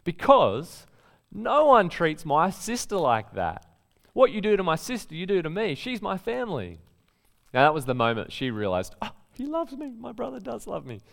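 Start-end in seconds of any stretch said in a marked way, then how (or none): 0.0-6.8 higher and louder, a big brother scolding; protective telling-off. Retold or imitated. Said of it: "he gave him a mouthful". 9.0-11.0 put-on much higher, soft girlish voice; dawning delight at being loved; touched sister. Sister's moment of realizing.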